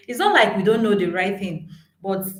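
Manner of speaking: usual speaking voice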